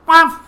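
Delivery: high, loud little yap; little dog yap. Shown as a demonstration. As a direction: yap like a tiny chihuahua. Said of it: "the little ones go woof".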